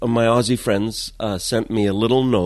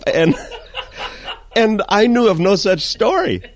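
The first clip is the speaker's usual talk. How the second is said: ordinary talk, nothing marked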